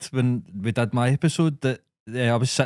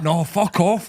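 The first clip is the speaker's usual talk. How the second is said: mildly heated, higher-pitched refusal; retold angry refusal; 6 semitones higher than usual. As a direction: act out digging your heels in. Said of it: "started getting angry".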